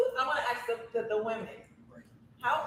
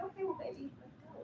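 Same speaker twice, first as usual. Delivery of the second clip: much softer, coaxing bedroom invitation; 6 semitones higher, 13 dB quieter than usual. Acted. Acting out initiating with her man, soft coaxing. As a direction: drop the volume and coax a lover.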